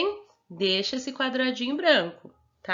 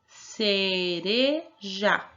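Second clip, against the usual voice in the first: "cereja" stretched into ce, re, ja; drawn-out teaching demo of "cereja"; slow syllable-by-syllable; much slower than usual. Shown as a demonstration. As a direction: say "cereja" very slowly with a gap before "ja". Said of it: "vamos falar devagarinho".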